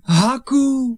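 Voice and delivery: joyful exclamation. Acted. Boy recognising his friend thought dead, overjoyed. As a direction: burst out with the friend's name.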